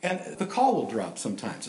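normal talking voice

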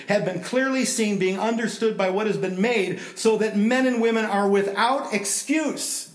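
measured, emphatic Bible reading; scripture reading. Acted. Preacher to congregation, reading Romans with emphasis. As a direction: lay out the verse with weight.

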